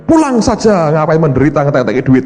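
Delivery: high, loud mock of the pastor's sing-song; a cheeky impression of Pak Yusak; imitating Pak Yusak. Preacher imitates Pak Yusak telling the sick to go home.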